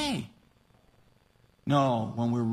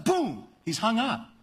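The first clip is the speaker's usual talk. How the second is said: ordinary talk, nothing marked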